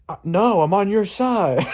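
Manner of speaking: high, drawn-out imitated protest; mock protesting bystander. Accused bystander answering the heckler, high mock protest. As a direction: imitate a wrongly accused man pleading his case.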